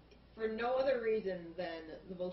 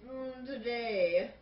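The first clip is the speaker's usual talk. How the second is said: drawn-out, higher mock diary voice; mock diary opening, loud and stretched out; diary entry; 4 semitones higher, 5 dB louder, much slower than usual. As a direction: read it like a dramatic diary entry, very slowly. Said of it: "like, diary entries".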